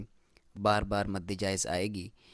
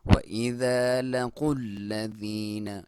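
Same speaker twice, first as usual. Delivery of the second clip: slow, drawn-out tajweed chant; slow Quran recitation; 3 dB louder, much slower than usual. Shown as a demonstration. Teacher reciting the verse for students, slow and drawn out. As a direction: recite it slowly, stretching each vowel. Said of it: "क़ाफ को मोटा".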